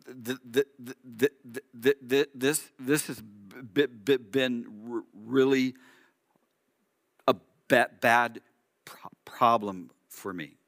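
heavy stutter, stuck on first sounds; stammering Moses. Acted. Moses stammers to God about his speech problem, stuttering haltingly. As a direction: struggle to force each word out, repeating opening consonants. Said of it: "he appears to have a stuttering or a stammering problem".

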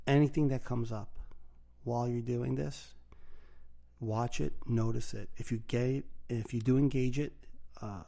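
soft, calm meditation guide; guided meditation. Acted. Leads a breathing meditation.

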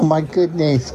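ordinary talk

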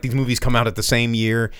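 usual speaking voice

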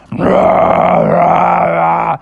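loud repeated "rah" growl; bear roars. Acted. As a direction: roar like bears misheard by humans. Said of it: "people could only hear it as".

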